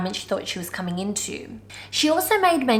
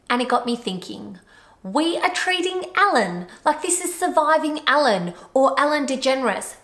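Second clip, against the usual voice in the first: normal talking voice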